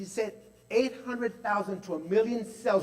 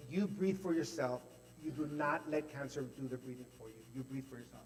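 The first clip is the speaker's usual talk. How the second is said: soft, gentle and steady; 5 semitones lower, 10 dB quieter than usual. Retold or imitated. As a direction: reassure softly, like a kind coach. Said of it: "it was so gentle, it was such a beautiful, gentle voice".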